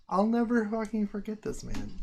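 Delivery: high, stressed never; emphatic. Real, not acted. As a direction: insist with grateful emphasis. Repeats thanks.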